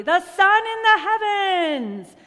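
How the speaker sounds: soaring, loud proclamation; high, exultant call; triumphant cry. A narrator's reading. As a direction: present the climax like a showman. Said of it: "cried Giovanni".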